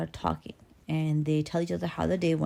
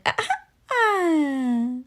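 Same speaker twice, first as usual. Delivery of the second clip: put-on high, drawn-out baby squeal; fearless baby; 11 semitones higher, 4 dB louder, much slower than usual. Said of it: "the baby just looks at him like".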